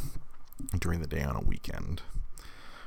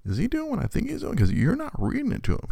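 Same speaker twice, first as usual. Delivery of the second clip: amazed. Retold or imitated. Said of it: "I'm like".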